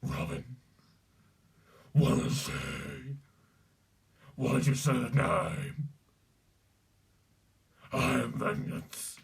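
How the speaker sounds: guttural, throat-shredding rasp; harsh unvoiced gravel voice; Batman. Shown as a demonstration. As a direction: growl it out like a masked avenger. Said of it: "Batman's quite easy to do".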